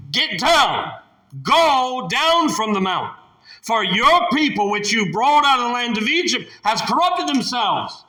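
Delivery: high, loud stern command, slowing on go down; angry God. Acted. God ordering Moses off the mount, stern and loud. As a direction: thunder down God's order to Moses. Preacher voices God's command.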